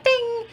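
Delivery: high little ding sound, quick and light; bell ding. Pretend. Host to viewers, miming the subscribe bell: a high, playful ding. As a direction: mimic a notification bell chime.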